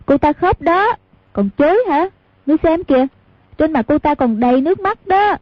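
put-on like a child telling on someone; simple girl butting in. A narrator's reading. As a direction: naive girl blurting out that she cried. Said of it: "Cô ngốc đột nhiên nói chen vào".

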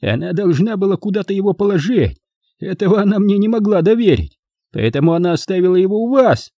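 high-pitched aggrieved accusation; offended. A narrator's reading. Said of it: "произнёс он обиженным голосом".

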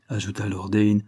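ordinary delivery

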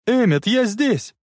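high, drawn-out call for attention; shouting out. A narrator's reading. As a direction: call out slowly, pitched high. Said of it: "Она высунулась из окна и закричала". (громкий высокий окрик)

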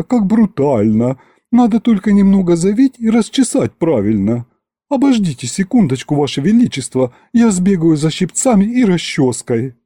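affected, swooning admiration, rising high; gushing butler. Acted. Butler admiring the sovereign's beard, gushing. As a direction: gush like a smitten, fussy servant. Дворецкий восхищается бородой.

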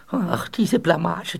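slow, drawn-out sigh of shame; embarrassed lament. Acted. Ghost stuck in the wall bemoans his disgrace, slow lament. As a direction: moan it slowly, mortified.

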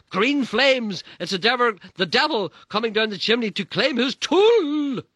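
very high, loud frenzied cry; manic yelling. Acted. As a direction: shriek it in ecstasy. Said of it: "she yelled".

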